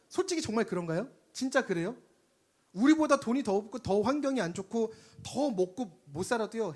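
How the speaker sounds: normal talking voice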